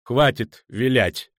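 slow, distinct, firm delivery; measured, emphatic statement; clear and calm. A narrator's reading. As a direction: say it deliberately, putting an end to evasion. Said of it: "произнёс чётко и спокойно".

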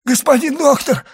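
high, loud cry to the doctor. A narrator's reading. Stricken servant calls the doctor for help, crying out. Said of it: "крикнул Барруа".

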